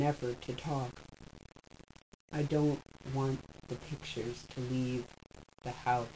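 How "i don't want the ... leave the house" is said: quiet. A narrator's reading. Trix numb after the scene.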